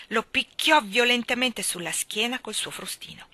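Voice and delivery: plain reading voice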